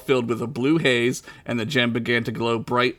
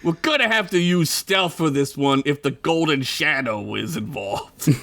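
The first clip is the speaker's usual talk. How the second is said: put-on Italian wiseguy accent. Joey warning the crew about stealth, Italian accent. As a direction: lay out the plan in an Italian accent. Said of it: "I gotta do an Italian accent now".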